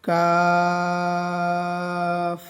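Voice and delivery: কাফ held very long; long chanted কাফ; drawn-out kaf. Shown as a demonstration. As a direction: stretch কাফ four alif lengths. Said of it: "চার আলিফ টান হবে".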